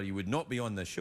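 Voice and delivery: mock threat. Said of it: "It's a joke".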